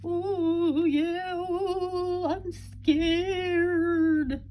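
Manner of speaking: high, hammed-up frightened ooh; mock scared. Shown as a demonstration. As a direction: play scared for the kids. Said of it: "so how were you acting".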